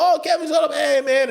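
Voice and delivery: high-pitched mimicked hail to a friend; excited greeting. Comics hanging out greet Kevin, imitated in an excited high voice. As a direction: act out spotting a pal arriving.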